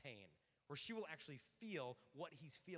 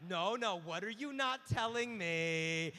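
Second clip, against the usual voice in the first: loud, slow, hammed-up interrogation; mock demanding; 13 dB louder, slower than usual. Woman suspecting her man holds back, mock demanding. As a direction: demand it loudly, stretching the words.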